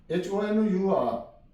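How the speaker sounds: ordinary delivery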